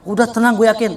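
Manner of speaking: suara lebih tinggi dan keras, sok santai; teman sok yakin. Retold or imitated. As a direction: tiru teman yang menenangkan dengan yakin. (pengendara berani menenangkan temannya, sok yakin)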